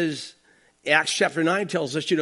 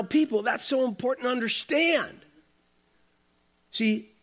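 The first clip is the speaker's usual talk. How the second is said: ordinary delivery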